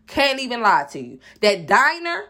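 usual speaking voice

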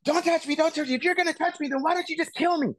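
very high, frantic imitation of a panicking man; shriek-pitched pleading without extra volume; mock panic attack. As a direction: act out someone freaking out, voice way up high. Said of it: "going into full on panic attack".

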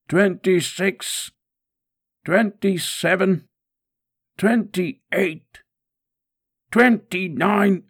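high, drawn-out cries, building; mounting pain with each number; agonised count. Acted. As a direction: cry out each count under the lash.

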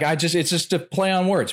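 pitched well up, a hammed-up Bill Burr rant; imitating Bill Burr. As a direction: push the voice high and animated, mocking a comic's bit. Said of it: "He's like".

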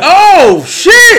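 high, loud drawn-out yell; big high-pitched reaction shout; shocked exclamation. Real, not acted. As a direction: holler it in thrilled shock. Listener reacts to the beating.

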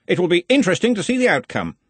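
cheerfully curious, untroubled; lightly amused good cheer. A narrator's reading. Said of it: "said Ridcully cheerfully".

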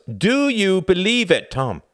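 loud, high, slow demand; forceful demand. A narrator's reading. Tom demanding an answer from Reginald, forceful. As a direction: raise the voice and stretch each word. Tom presses a third time.

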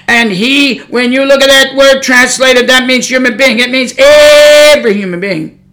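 loud, forceful pulpit delivery; fiery preaching. Real, not acted. Preacher rebuking lying preachers with God's word, fiery preaching. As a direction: preach it hard, stretching key words.

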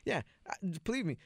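ordinary talk

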